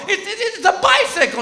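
high, stammering defense; flustered husband. Retold or imitated. Husband defending his drawing, flustered. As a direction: stammer out the answer. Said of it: "And I go".

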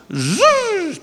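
high whizzing zzz; zipline whizz. Retold or imitated. Daughter flies down the cable, high whizzing zzz. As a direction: imitate the cable zipping by. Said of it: "she goes".

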